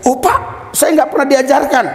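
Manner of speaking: high-pitched retold protest; acted surprise. Preacher voices the officer told about fasting prayer, high surprised protest. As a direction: act out a taken-aback, high-voiced objection.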